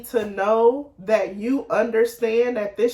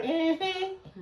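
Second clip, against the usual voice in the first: higher, softer dismissive hum; skeptical acknowledgment; 6 semitones higher, 8 dB quieter than usual. Retold or imitated. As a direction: hum it like you aren't falling for it. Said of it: "I be like".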